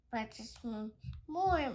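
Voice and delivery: ordinary delivery, nothing marked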